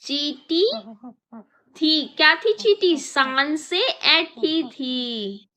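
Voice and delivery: slow, drawn-out prompt; prompting the class. Shown as a demonstration. Teacher drawing out the words for her pupils to fill in. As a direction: leave room for kids to answer. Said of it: "fill in the blanks".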